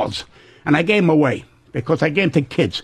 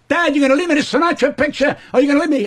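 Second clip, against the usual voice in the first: higher, pleading son voice; mock a greedy son asking Dad; whiny grown kid; 7 semitones higher, faster than usual. Old comic voices his son asking about the inheritance, higher and pleading.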